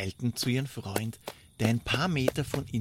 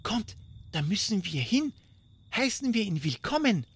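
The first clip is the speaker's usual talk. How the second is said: high, eager excitement; thrilled to go; excited; 9 semitones higher than usual. Acted. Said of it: "Kaspar war ganz aufgeregt".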